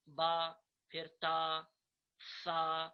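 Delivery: slow, loud "با، تا، سا" with long aa; Arabic letter names. Shown as a demonstration. Teacher follows the arrow on screen, drawn-out Arabic pronunciation. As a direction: hold the aa in "با، تا، سا" on a level pitch. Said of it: "ان کے ساتھ ہم الف ملا دیتے".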